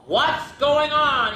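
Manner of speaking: high, drawn-out surprise; startled storybook parent; astonished question. A narrator's reading. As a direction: stretch it out in amazement. Father owl arrives at party.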